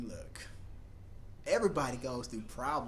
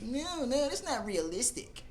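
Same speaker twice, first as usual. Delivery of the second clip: higher scoffing viewer voice; mock skeptic viewer; 9 semitones higher, slower than usual. Fans scoffing at her pull-ups, higher scoffing voice. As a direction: scoff like a nitpicking fan. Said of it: "people are gonna be like".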